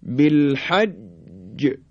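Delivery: "بالحج" very slow, ج held then bounced; doubled-jim qalqalah. Shown as a demonstration. Teacher demonstrating jim, "بالحج" with held, bounced ج. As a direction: hold ج in "بالحج", then release it. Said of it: "در حرف جیم توجه بفرمایید".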